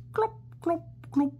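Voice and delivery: playful hoofbeats; clip-clop. Shown as a demonstration. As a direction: click it out like hooves. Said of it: "like horse feet".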